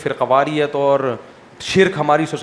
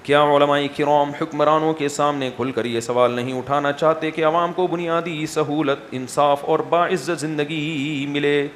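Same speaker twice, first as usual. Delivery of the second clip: reading question aloud; 2 semitones lower, 4 dB quieter than usual. Real, not acted. Mufti reads out a question put to religious scholars, formal read-out. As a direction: read a written question evenly, stretching key phrases.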